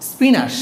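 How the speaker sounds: স্পিনাশ ending in a long "aash"; common mispronunciation. Shown as a demonstration. Teacher gives the word as locals say it, common mispronunciation of স্পিনাশ. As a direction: say স্পিনাশ as "spee-naash". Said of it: "এটাকে বলি স্পিনাশ".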